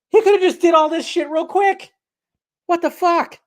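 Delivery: high-pitched, incredulous mock outrage; flabbergasted mover. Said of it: "imagine being, like, a mover".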